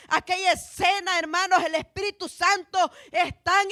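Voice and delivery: forceful, projected sermon delivery; preaching. Real, not acted. Preacher exalts the Holy Spirit to the church, forceful preaching. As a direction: proclaim it with conviction to the congregation.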